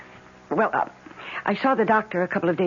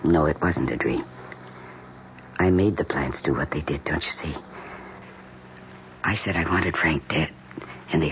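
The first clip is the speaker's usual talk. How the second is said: low voiceless whisper, pleading; urgent whisper; 4 dB quieter than usual. Acted. Wife whispering her terrible secret to her husband. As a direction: hiss the confession so no one hears. Desperate hushed confession.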